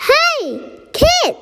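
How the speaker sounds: high-pitched call after someone. A narrator's reading. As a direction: call out to a kid walking off. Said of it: "I call out".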